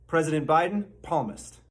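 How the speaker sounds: ordinary delivery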